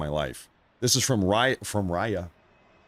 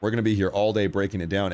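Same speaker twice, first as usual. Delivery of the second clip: usual speaking voice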